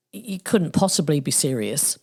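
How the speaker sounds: usual speaking voice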